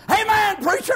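high, loud church holler; hollered amen. Retold or imitated. Old man in the front pew hollering to the preacher, loud. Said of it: "Conway would holler".

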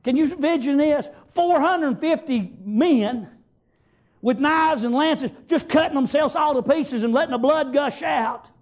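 normal talking voice

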